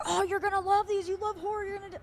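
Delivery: put-on excited kids. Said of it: "my nieces and nephews are like".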